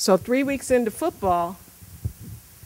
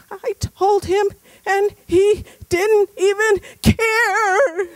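very high, slow and louder wail; imitated sobbing boy to his mom; tearful son; 14 semitones higher, 9 dB louder, slower than usual. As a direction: drag out the child's heartbreak. Son tells mom, crying.

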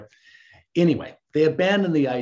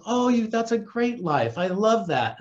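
higher-pitched retold enthusiasm; mock gushing admiration; 7 semitones higher than usual. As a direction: gush as if imitating an eager admirer. Retold gushing reply to Penny.

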